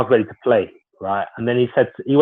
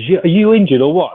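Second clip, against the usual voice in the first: retold angry yell, higher and louder; angry manager shout; 8 semitones higher, 6 dB louder than usual. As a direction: demand it like a furious manager. Manager confronts player in changing room.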